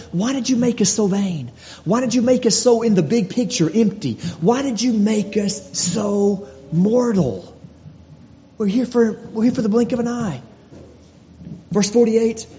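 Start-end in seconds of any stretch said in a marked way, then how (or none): 0.1-10.4 higher, pleading voice of the psalmist; psalmist's lament. Acted. Preacher voicing the psalmist's lament to God, high and pleading. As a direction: plead with God, slowing on mortal. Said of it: "the psalmist is saying".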